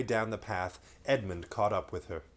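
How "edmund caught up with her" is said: ordinary delivery, nothing marked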